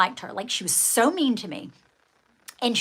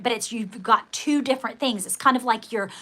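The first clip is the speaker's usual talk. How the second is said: usual speaking voice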